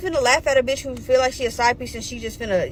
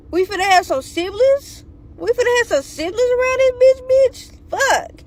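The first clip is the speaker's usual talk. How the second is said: high-pitched, climbing mock disbelief; incredulous outburst; 9 semitones higher than usual. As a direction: repeat it higher, as if you can't believe it. Acted disbelief, repeating the news.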